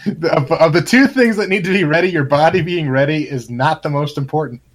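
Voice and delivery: ordinary delivery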